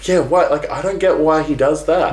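a lightly voiced puzzled character; mock puzzled. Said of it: "he's like".

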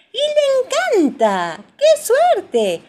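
very high, loud, stretched delight; excited cheer. A narrator's reading. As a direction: squeal it with joy for a small child. Narrator cheers George's delight in pool.

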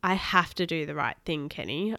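ordinary delivery, nothing marked

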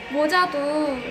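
normal talking voice

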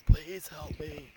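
soft, slow, breathy-free hushed plea, lightly imitated; mock whisper. A villain begging softly to the hero who beat him. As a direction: drag it out quietly like a beaten villain begging. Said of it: "he's basically whispering".

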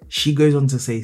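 ordinary delivery

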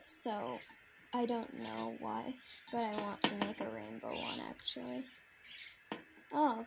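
ordinary talk, nothing marked

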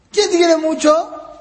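put-on high, slow coaxing question to a small child; playful teasing. Father keeps quizzing his little daughter, playful high singsong. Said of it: "Yo siempre digo de vacilado".

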